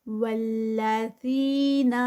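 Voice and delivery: والذین with ذی held two counts; slow recitation. Shown as a demonstration. Teacher models the word, slow recitation. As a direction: recite والذین slowly, stretching ذی. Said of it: "ولا اور پھر ذی".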